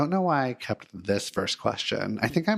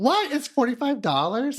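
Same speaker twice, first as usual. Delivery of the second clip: high, loud squeak of disbelief; shocked disbelief; 4 dB louder than usual. Retold or imitated. Retelling the dump clerk, shocked at the price. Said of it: "I was like".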